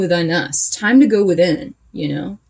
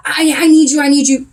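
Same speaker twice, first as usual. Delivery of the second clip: mock needy plea, louder; clingy partner; 4 semitones higher, 4 dB louder than usual. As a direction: ham up a clingy lover begging. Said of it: "some clingy".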